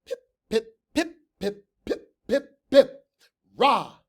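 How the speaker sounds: high clipped chant; prim cheer. A narrator's reading. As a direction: chirp it like a prim squad.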